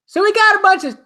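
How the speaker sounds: a badly faked Chris Rock rant; Chris Rock attempt. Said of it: "trying to pinpoint a Chris Rock impression".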